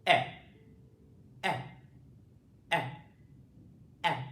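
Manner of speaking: short eh, eh, eh with the mouth open; open eh vowel. Shown as a demonstration. Teacher models the short vowel, open eh. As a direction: clip each eh and pause. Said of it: "my mouth is open".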